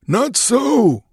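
very high, drawn-out objection; old man's cry. A narrator's reading. Older man contradicts the warrior, crying out. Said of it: "cried an older man".

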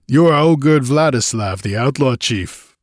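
name your captor defiantly; defiant captive. A narrator's reading. Captive defiantly names his captor.

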